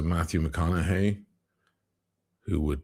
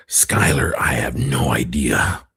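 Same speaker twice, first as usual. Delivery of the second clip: lower, loud, breathy gravel-free growl of a line; 5 semitones lower, 5 dB louder than usual. Retold or imitated. Imitating Bryan Cranston's low voice, pushed out. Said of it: "He's all the way down there".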